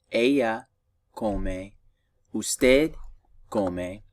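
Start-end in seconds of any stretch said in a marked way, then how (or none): none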